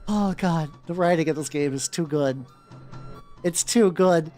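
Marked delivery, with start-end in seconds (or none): none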